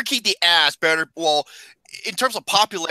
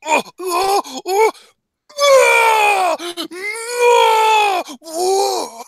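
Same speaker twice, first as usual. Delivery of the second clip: high "oh" cries growing louder; drawn-out wails; 13 semitones higher, 6 dB louder, much slower than usual. Pretend. Co-host reacting to Lexington Steele's name, high mock wailing. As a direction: wail a string of rising ohs.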